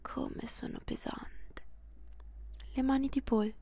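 very soft, slow whisper; drifting faint whisper. Acted. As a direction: barely breathe it.

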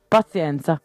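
plain reading voice

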